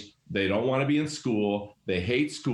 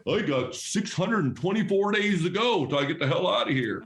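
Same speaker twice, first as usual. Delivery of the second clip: higher, mock-weary countdown to leaving; burned-out teacher; 7 semitones higher than usual. Principal voices a burned-out teacher counting days to retirement, mock weary. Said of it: "You know what they're saying?".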